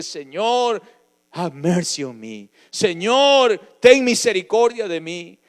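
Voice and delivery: loud, high desperate plea to God; crying out for mercy. Acted. Blind beggar crying out to the Lord, loud desperate plea. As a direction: shout the plea with everything you have. Pleading prayer cry to God.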